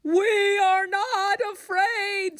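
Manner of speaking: high, slow scared scream; scream together. A narrator's reading. Frog and Toad scream as the hawk leaves, high. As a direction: yell it to hide the fear. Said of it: "screamed Frog and Toad".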